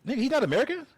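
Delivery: high, fast disbelieving question; high, quick "are you serious" retort; incredulous. Real, not acted. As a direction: fire it back in disbelief. Arguing whether Cavill uses accent.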